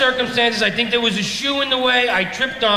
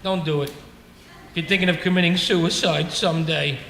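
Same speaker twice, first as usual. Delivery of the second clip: ordinary talk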